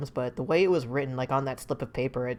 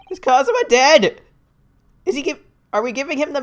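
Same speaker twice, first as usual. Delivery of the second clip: high, loud, disbelieving question; shocked; 11 semitones higher, 9 dB louder than usual. Real, not acted. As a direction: blurt it out in shock. Player shocked at character's death.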